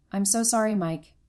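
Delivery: usual speaking voice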